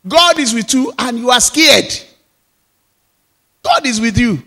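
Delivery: high, loud, driving litany; impassioned pulpit refrain; preaching at full force. Acted.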